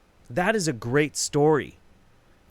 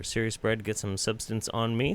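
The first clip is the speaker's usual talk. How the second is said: normal talking voice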